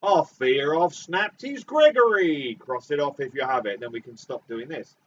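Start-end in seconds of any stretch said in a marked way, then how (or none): 0.0-2.6 exaggerated Cockney accent. Shown as a demonstration. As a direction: ham up a London Cockney. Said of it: "This is, this is Cockney".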